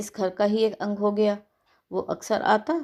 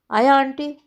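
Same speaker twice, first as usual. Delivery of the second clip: calling out; 5 semitones higher, 5 dB louder than usual. A narrator's reading. Boy calls out from inside.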